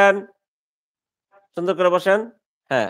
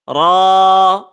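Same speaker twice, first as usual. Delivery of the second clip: normal talking voice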